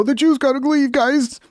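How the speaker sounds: high, sneering mock follower. Mocking the movement's followers.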